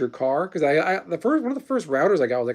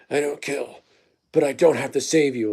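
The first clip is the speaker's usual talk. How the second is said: Batman. Retold or imitated. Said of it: "He's like".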